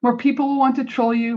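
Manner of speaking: normal talking voice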